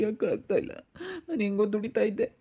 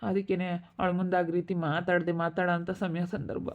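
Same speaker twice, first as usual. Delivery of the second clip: ordinary delivery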